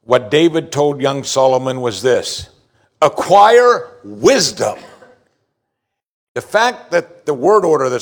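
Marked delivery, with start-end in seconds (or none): 3.0-4.8 ACQUIRE WISDOM, slow, loud and higher, both words punched; emphatic exclamation. Shown as a demonstration. Preacher voicing David's charge to young Solomon, emphatic exclamation. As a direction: exclaim "Acquire wisdom" with drawn-out, forceful stress. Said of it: "with the emphasis it needs".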